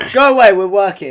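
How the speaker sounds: loud mock telling-off; mock shooing. Man reasoning with the snake, loud mock shooing. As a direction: call out as if shooing it.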